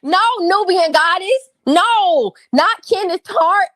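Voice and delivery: loud, slow, high-pitched objection; emphatic correction. Real, not acted. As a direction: raise your voice and drag out the correction. Loudly corrects a listener.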